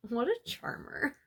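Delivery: ordinary talk, nothing marked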